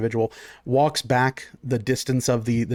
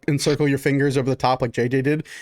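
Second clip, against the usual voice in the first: ordinary talk, nothing marked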